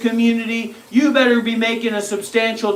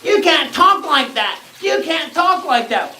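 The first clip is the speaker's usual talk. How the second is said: high, mocking whine of a critic; parroting the complaint in a high whine; whiny mock objection; 8 semitones higher than usual. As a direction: mimic the scold in a high nagging voice.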